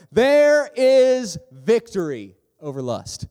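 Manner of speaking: loud, high, drawn-out announcement; triumphant proclamation. Real, not acted. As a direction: proclaim it big and slow, word by word. Proclaims the final sermon point.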